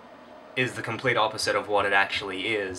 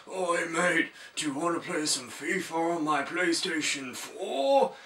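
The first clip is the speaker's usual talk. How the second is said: higher, softer mock British street accent; 7 semitones higher, 3 dB quieter than usual. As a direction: put on a cheeky London geezer accent. Homeless guy voiced in story.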